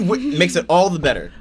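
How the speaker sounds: high, loud laughing question. Real, not acted. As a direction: laugh while you ask it. Laughing, teasing her friend.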